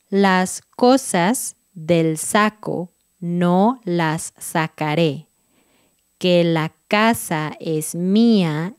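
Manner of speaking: tongue twister read slowly and evenly; slow, careful tongue twister reading. Shown as a demonstration. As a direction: read the twister at half speed, vowels open. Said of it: "súper despacito".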